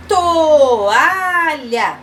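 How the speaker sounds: high, loud, stretched syllables. Shown as a demonstration. Teacher naming a picture for children, syllable by syllable. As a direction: sound out each syllable at length.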